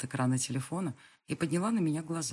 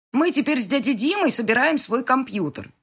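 put-on much higher, loud kid's exclamation; excited child shout; 9 semitones higher, 10 dB louder than usual. A narrator's reading. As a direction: blurt it out like an eager boy. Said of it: "восклицает сын".

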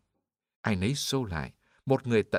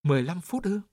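high, louder cry of disbelief; startled exclamation; 8 semitones higher, 4 dB louder than usual. A narrator's reading. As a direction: blurt it out in shock, voice jumping up. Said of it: "kêu lên". (kêu lên sửng sốt, giọng vút cao)

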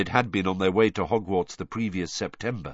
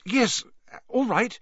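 flustered; 13 semitones higher than usual. Acted. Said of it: "looking slightly flustered".

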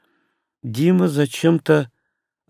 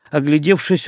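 ordinary delivery

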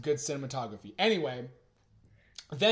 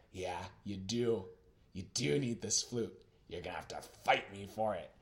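menacing killer; 7 semitones lower, 7 dB quieter than usual. Acted. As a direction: taunt him softly and deep, savoring it. Killer's menacing challenge, acted.

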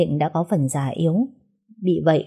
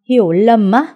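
higher, louder roar of disbelief on á; 5 semitones higher, 8 dB louder than usual. Acted. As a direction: roar it like a wild animal. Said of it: "gầm lên như một con thú hoang".

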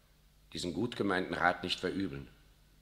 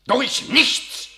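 much higher and louder, voice climbing in outrage; indignant cry; 11 dB louder than usual. Acted. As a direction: hurl the words up in disbelief. General's rising indignation.

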